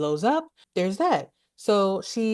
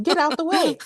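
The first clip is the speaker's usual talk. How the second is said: much higher, exasperated mock command; exasperated urging; 11 semitones higher, 4 dB louder than usual. Viewer to Lambert blocking the flamethrower: exasperated. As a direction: pitch shoots up, playfully fed up.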